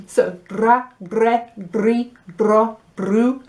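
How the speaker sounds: "dra, gre, dri, dro, dru" with a rolled r; rolled dr syllables. Shown as a demonstration. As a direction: roll the r in each "dra" syllable. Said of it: "It's good to try with a drat".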